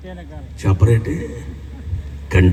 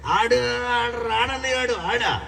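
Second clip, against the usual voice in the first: much higher, slow, laughing woman's voice; imitating a sister; 12 semitones higher, 5 dB quieter, slower than usual. Sister telling the preacher her husband won't come, high laughing voice. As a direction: mimic the sister answering while chuckling. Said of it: "ఓ చెల్లెని అడిగితే".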